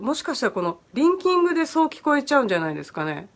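ordinary delivery

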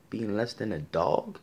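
ordinary delivery

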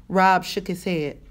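plain reading voice

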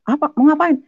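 a father yelling at his son; father's alarmed shout. Retold or imitated. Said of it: "Bapak saya datangnya".

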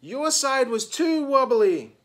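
high, loud accusing shout; angry shout. A narrator's reading. Meg blames Simone for the collapse, shouting. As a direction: shout the blame at your partner. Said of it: "shouted Meg".

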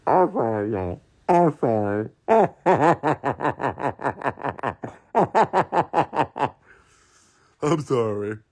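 helpless laughter breaking up an apology; laughing till crying. Real, not acted. Apologising to viewers, laughing to tears. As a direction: apologise through a long laughing fit. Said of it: "I'm laughing from crying".

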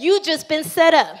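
ordinary delivery

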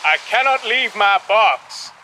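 high, strained insistence; shouted refusal. A narrator's reading. Passenger to captain from the sinking ship, shouting. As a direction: call back a stubborn refusal. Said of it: "Cornelius shouted again".

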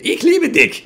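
high, loud hammed-up "Ich liebe dich"; mock sexy German. Shown as a demonstration. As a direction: overdo a seductive German line for laughs. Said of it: "If you say it slowly and sweetly, it's very sexy".

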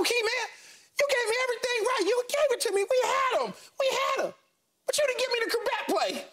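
squeaky, very high-pitched voice; Monty. Retold or imitated. Coach complaining to his player about the lost game, squeaky. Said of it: "in his little squeaky voice".

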